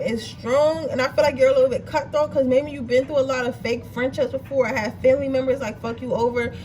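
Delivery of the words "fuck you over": normal talking voice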